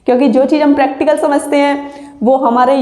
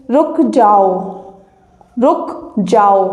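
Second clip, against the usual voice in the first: रुक जाओ said slowly and lower, falling; slow example phrase; 4 semitones lower, much slower than usual. Shown as a demonstration. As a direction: demonstrate रुक जाओ word by word, low. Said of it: "जैसे". (धीरे-धीरे, ठहर कर)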